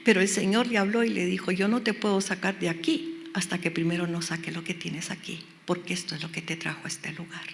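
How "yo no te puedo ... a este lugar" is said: low, soft voice fading towards a whisper; God's hushed answer. Retold or imitated. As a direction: answer quietly and gravely as the Lord. Voices God answering the prisoner.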